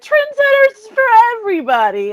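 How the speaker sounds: high, singsong hype imitation; mock announcement. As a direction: mimic a giddy giveaway to the whole crowd. Mimics brand's re-release announcement.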